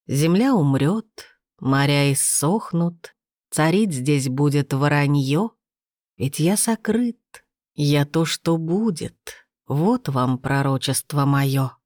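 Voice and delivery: slow, measured verse reading; prophecy recitation. Acted. Narrator opens the chapter with a riddling prophecy in verse, slow solemn recitation. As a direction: intone each line slowly, with pauses between.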